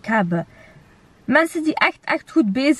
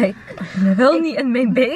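ordinary talk, nothing marked

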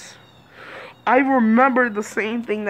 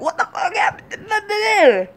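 laughing mock parent; 11 semitones higher, slower than usual. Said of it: "they're like".

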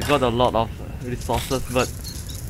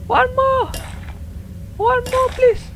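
very high pleading whine; pleading squeal; slower than usual. Real, not acted. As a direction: beg for one more, squeaky. Begging for the last item.

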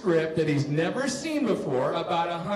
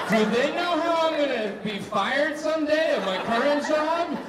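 high, eager, laughing over the future boss; giddy excitement; 6 semitones higher, 4 dB louder than usual. Retold or imitated. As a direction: gush like you just heard a secret. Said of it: "And I was like".

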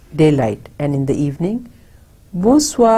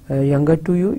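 ordinary delivery

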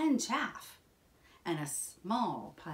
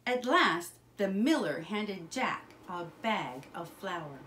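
usual speaking voice

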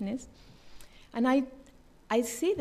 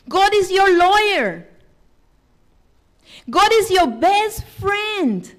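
loud, rising proclamation, slowing at the end; preaching; 3 semitones higher, 9 dB louder than usual. Acted. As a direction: build each God is line. Preaching at full force.

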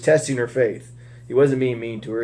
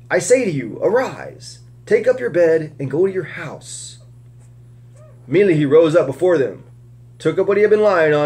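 raised, earnest reading voice; scripture reading; 4 semitones higher than usual. Acted. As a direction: read the Gospel story aloud to the group. Reading a healing story aloud.

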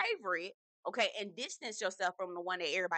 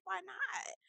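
lightly raised mock voice of a carefree Jessel; imitating Jessel; 11 semitones higher, 6 dB quieter than usual. Vlogger playing Jessel answering her, lightly raised innocent voice. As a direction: pitch up, innocent and unbothered. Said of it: "She was like".

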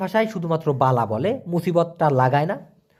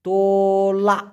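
slow demo; much slower than usual. Shown as a demonstration. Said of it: "এটাকে বলা হয় তোলা".

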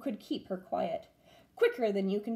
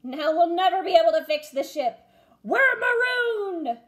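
despairing pirate yell; 8 semitones higher, 8 dB louder than usual. A narrator's reading. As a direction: wail that all is lost. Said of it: "yelled Braidbeard".

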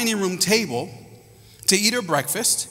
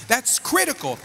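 higher, building sermon cadence; preaching at full force; 7 semitones higher than usual. Acted. Pastor urging fathers to pray for children, fervent preaching.

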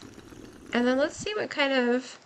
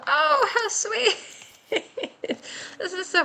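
gushing; 10 semitones higher than usual. Real, not acted. Player charmed by the new options, high gushing. Gushing over a sweet item.

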